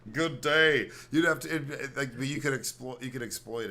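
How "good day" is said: loud, high, stretched-out greeting; grand entrance greeting. Pretend. Gliding dance teacher greets the class on arrival, loud and grand. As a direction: sweep into the room announcing yourself.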